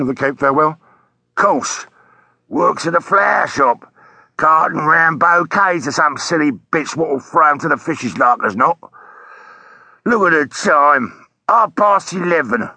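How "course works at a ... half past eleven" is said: disgruntled taxi driver. Acted. Taxi driver telling a policeman about his missing fare, disgruntled. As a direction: complain like a put-out London cabbie.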